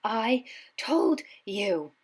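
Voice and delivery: P=A; gasped. A narrator's reading. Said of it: "Hermione gasped".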